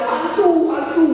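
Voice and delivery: retold cry. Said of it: "Lalu mereka berteriak-teriak".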